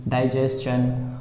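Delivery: डाइजेशन stretched slowly with a stchun ending; slow demo pronunciation. Shown as a demonstration. Teacher slowly modelling डाइजेशन for students. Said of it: "इसको चन पढ़ेंगे हम इसे".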